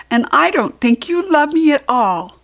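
put-on high, loud sulky child voice; grumpy child. Small telling Large he is cross, a high sulky whine. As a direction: sulk it out like a cross little one. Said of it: "said Little Small".

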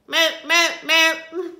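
short, loud, high moos; duck faking moo. A narrator's reading. Duck tricking the thieves, loud mooing. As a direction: moo in quick bursts. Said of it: "the duck in the pond said".

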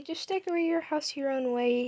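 ordinary talk

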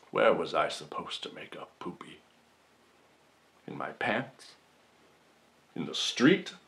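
low, soft, hurried near-whisper; hushed mock pleading. Accused man asks the jury about his hour of need, hushed. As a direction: drop to a hushed, wounded courtroom plea.

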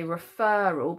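"referral" with a long, stressed "er" in the middle; stress on the FER. Shown as a demonstration. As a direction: stretch and punch the middle syllable of "referral". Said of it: "need that to sound like an er".

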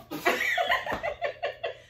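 shrieking high laugh. Real, not acted. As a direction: burst into squealing laughter. Partner misses throw.

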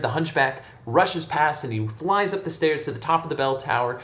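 ordinary delivery, nothing marked

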